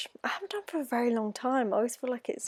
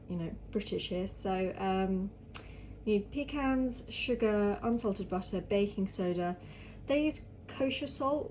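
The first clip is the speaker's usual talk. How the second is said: ordinary delivery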